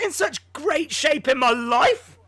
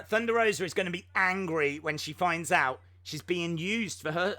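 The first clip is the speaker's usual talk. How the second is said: usual speaking voice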